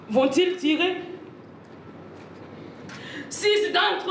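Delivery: slow, halting theatrical delivery; harrowing stage testimony. Acted. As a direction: draw out each item between long pauses. Témoignage de viol joué sur scène. (témoignage tragique, lent et entrecoupé de silences)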